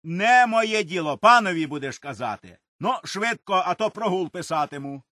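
loud, high, harsh reply; harsh retort. A narrator's reading. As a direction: bark the order back. Overseer answers harshly. (десятник різко й голосно відповідає)

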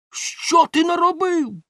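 very high, drawn-out wail; outraged cry. A narrator's reading. Baker scolds his helper over the spoiled dough, outraged cry. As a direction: cry out in dismay at the blunder. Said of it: "закричав Бомштекс".

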